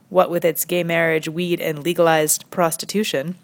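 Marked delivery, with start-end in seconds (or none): none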